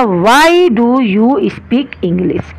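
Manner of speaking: very slow, word-by-word English sentence; slow dictation. Shown as a demonstration. Teacher to class, dictating the question word by word, very slow. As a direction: spell it out slowly for students. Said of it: "teacher dictating sentence".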